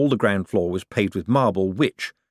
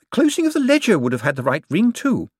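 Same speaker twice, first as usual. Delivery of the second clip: put-on much higher, cackling old woman voice; cheeky old lady; 11 semitones higher, 5 dB louder than usual. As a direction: play a sharp old dame with no fear. Topsy jokes back.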